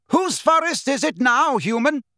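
loud, high, angry centaur challenge; fierce yell, voice pitched high; bellowed taunt. A narrator's reading. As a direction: bellow it in furious triumph. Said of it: "bellowed Bane".

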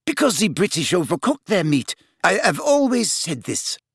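put-on bright French-accented lilt; happy French girl voice. A narrator's reading. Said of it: "said Fleur happily".

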